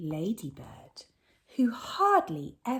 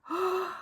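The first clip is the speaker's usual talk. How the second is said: gasp; 12 semitones higher, 4 dB quieter than usual. Acted. Sack opened, surprise.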